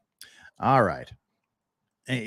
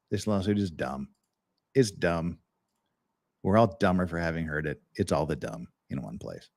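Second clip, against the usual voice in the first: a lower, quieter mock of Kurt's voice; Kurt impression; 9 semitones lower, 8 dB quieter than usual. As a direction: do your best Kurt, low and deadpan. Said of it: "that's my best Kurt impression".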